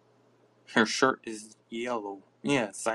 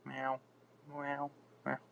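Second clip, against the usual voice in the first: soft meowing; 4 semitones higher, 11 dB quieter than usual. Pretend. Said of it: "Good quality meowing".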